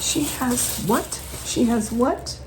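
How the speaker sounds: high, hushed stunned repeat; shocked disbelief. Retold or imitated. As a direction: retell the shock of the find. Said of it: "I was freaking out going".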